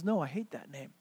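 low, soft grumbled refusal; quietly resentful; sulky protest. Acted. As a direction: object under your breath. Meshach's sulky reply to the bullies.

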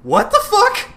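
Spider-Man gaping at the villain's odd greeting, high and laughing; incredulous Spider-Man. Retold or imitated. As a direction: squeal it out in amused disbelief. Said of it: "Spider-Man's like".

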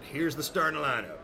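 ordinary talk, nothing marked

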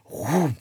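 big airy whoosh; imitated fire whoosh. Storyteller imitates the match igniting the gasoline, whooshing. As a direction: mimic gasoline suddenly catching fire.

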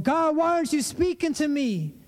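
high-pitched complaint to heaven; exasperated plea. Acted. We're over here going to God, exasperated plea.

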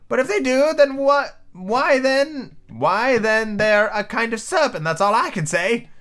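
very high, insistent bird voice; scornful pigeon. Acted. Pigeon calling Alice a serpent, high and scornful. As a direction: scold in a high squawk.

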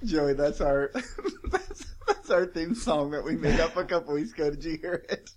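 talking through laughter; giggling while explaining; laughing. Real, not acted. Laughing about theme song.

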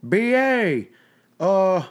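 high, drawn-out imitation of dad; put-on dad voice, slow and high; dad impression. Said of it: "You've got a good my dad voice too".

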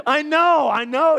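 loud, high, laughing I know; laughing admission. Real, not acted. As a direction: concede through a laugh. Laughing at himself.

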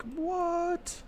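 high squeaky shocked what. Retold or imitated. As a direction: gasp it out in disbelief. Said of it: "Every book reader would be like".

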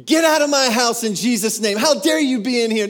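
loud, high commanding yell. Retold or imitated. Friend yelling at the demon in his room, loud and high. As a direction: drive it out with a full-voiced order.

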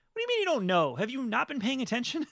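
high-pitched playful indignation; mock incredulous. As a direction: scold the doubters in mock disbelief. Mock reaction to doubters.

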